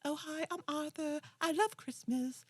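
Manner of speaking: over-eager British boy, badly faked; bad British accent. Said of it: "so bad British accent".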